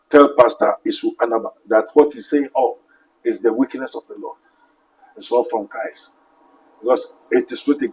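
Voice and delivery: solemn hushed rebuke; grave low voice. Acted.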